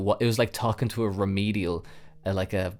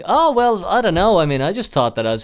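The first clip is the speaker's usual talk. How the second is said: higher, louder put-on girlfriend voice; the girlfriend shrugging it off; sheepish girlfriend; 8 semitones higher, 8 dB louder than usual.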